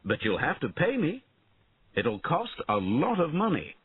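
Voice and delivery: lightly voiced cocky knight; bargaining knight. A narrator's reading.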